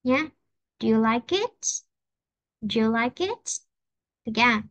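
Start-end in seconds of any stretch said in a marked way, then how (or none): 0.8-1.5 "it" lifted up at the end of "Do you like it?"; rising question tune. Shown as a demonstration. Teacher modeling a yes-no question for the class, rising tune. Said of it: "ngữ điệu sẽ đi lên". 2.6-3.4 "it" lifted up at the end of "Do you like it?"; rising question tune. Shown as a demonstration. Teacher modeling a yes-no question for the class, rising tune. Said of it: "ngữ điệu sẽ đi lên".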